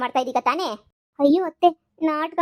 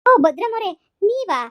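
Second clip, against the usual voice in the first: mock surprise; 6 semitones higher, 6 dB louder than usual. Mocking surprise at in-law.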